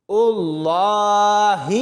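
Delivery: very slow Allah with a long falling close; Quranic recitation. Shown as a demonstration. Teacher reciting the joined word for the class, drawn-out recitation. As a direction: stretch the name out reverently as in recitation. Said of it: "حمزہ زیر ای".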